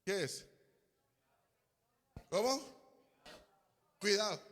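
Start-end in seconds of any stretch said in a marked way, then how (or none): none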